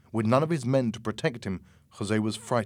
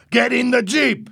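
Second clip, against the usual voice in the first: high, loud, urgent order; shouting; 13 semitones higher, 9 dB louder than usual. A narrator's reading. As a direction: yell the order across. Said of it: "Louis shouted".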